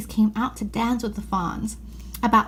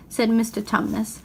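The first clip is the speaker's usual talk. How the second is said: ordinary delivery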